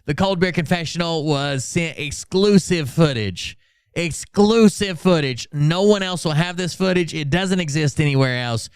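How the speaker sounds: ordinary talk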